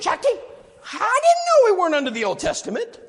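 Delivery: high, amazed discovery; surprised wife. Retold or imitated. Sheila to her husband Jackie, high and amazed.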